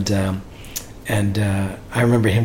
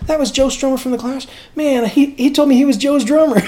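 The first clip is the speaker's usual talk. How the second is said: high-pitched, astonished Southern singer; imitated disbelief; 12 semitones higher than usual. Singer learning who the fan was, imitated astonishment. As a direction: protest in stunned disbelief, voice way up.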